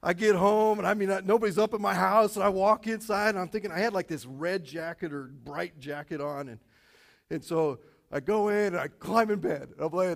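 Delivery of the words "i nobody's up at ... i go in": ordinary delivery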